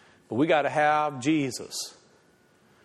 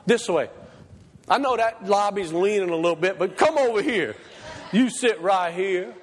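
fired-up preacher voice; full-force preaching; 10 semitones higher than usual. Acted. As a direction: preach it out to the congregation.

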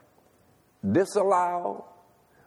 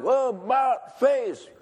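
drill command; 10 semitones higher, much slower than usual. Retold or imitated. Corporal finally commands.

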